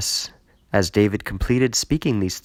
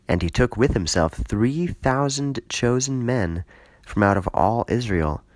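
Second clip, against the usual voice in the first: ordinary delivery, nothing marked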